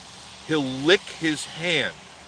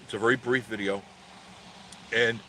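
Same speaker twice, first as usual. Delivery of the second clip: ordinary talk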